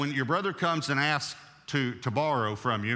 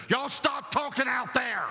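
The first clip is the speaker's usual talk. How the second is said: high, slow, yelled call to the next room; imitating a mother shouting at her kids; mother yelling at kids; 13 semitones higher than usual. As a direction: holler at the kids like the mother did. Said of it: "And then she did this".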